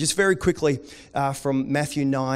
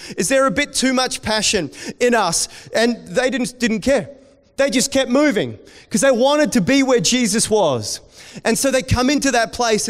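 louder and higher, passionate sermon build; preacher stirring the congregation, louder and higher; rising preaching; 6 semitones higher, 5 dB louder than usual. Acted. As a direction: preach it up with growing fire.

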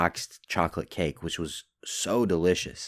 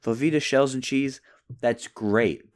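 usual speaking voice

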